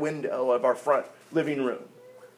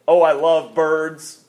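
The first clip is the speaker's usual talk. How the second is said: usual speaking voice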